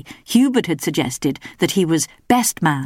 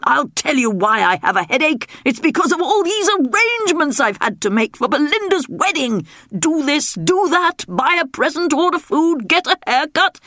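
ranting king; 10 semitones higher than usual. A narrator's reading. King ranting about wedding chores, high fussy voice. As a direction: list his woes like a harried king.

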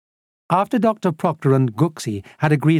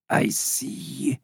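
soft, drawn-out, breathy pronouncement; seer's trance; 8 dB quieter than usual. A narrator's reading. Old smuggler starting his prediction, hushed and mystical. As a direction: stretch it out as if a vision is forming.

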